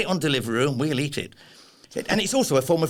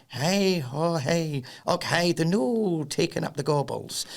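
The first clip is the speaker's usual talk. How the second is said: hammed-up, drawn-out Scottish brogue; stage Scot, stretching the vowels; Scottish accent; 3 semitones higher, slower than usual. Shown as a demonstration. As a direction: put on a comic Scottish accent. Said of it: "I can do a wee little bit of Scottish".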